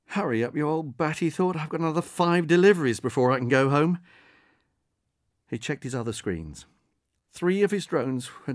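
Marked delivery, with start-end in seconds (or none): 0.1-4.0 higher, muttered-thought voice, a touch exasperated; impatient delivery man. Acted. Delivery man thinking about his other deliveries, impatient.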